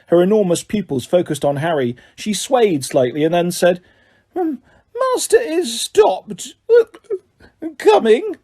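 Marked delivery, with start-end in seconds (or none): 4.3-8.4 very high, slow, slurring house-elf squeak; a tipsy elf hiccuping out her words; high drunken house-elf voice; drunk squeaky elf. A narrator's reading. Drunk house elf Winky speaks.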